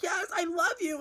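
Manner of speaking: high-pitched girlfriend impression; imitated girlfriend. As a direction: ask it in a high imitation of her. Voicing the girlfriend's reply.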